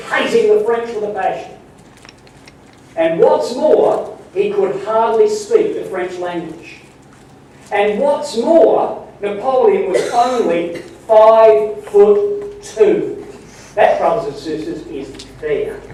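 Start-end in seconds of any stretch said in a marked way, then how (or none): 3.0-13.2 slow emphatic. Real, not acted. Preacher slows for emphasis.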